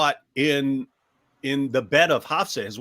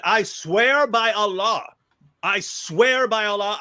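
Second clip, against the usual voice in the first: slow, higher solemn swearing; mock oath; 6 semitones higher, 4 dB louder, slower than usual. Muhammad swearing to his wives, slow mock oath. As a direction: drag out the oath in earnest mock.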